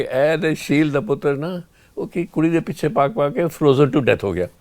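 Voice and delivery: hammed-up gossip in Punjabi; mock Punjabi gossip. Imagined Punjabi gossip about him.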